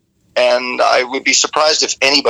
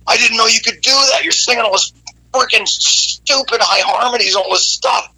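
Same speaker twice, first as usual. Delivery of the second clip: put-on New York Italian accent; 10 semitones higher than usual. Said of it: "total New York Italian".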